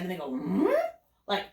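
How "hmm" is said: short rising dog-like hum; curious puppy "hmm?"; wordless questioning noise, swooping up. Acted. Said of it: "or even my little pups".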